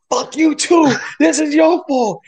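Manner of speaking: high, loud heated shout; angry comeback. Retold or imitated. Driver curses back at injured cyclist, angry yelling. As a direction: yell the blame straight back, high-pitched.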